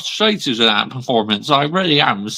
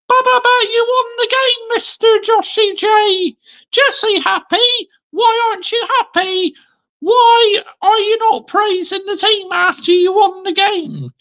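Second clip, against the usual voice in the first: much higher, mocking heckler voice; a shrill-pitched taunting fan; squeaky heckler; 3 dB louder than usual. Rival fan taunting Joshy J, high squeaky mock voice.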